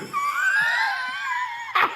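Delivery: screeching cackle of laughter; shrieking laugh. Real, not acted. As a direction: crack up at your own joke. Bursts out laughing.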